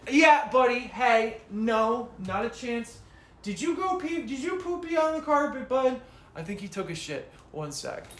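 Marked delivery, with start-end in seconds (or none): 0.1-6.0 higher, louder doggy voice; alarmed, higher owner voice questioning the dog; pet talk. Real, not acted. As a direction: quiz the puppy about what he did. Catching the dog misbehaving.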